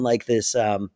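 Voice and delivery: usual speaking voice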